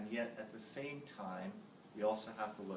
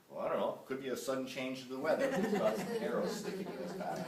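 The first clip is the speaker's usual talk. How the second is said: laughing punchline; 5 dB louder, faster than usual. Acted.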